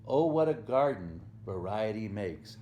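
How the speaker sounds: usual speaking voice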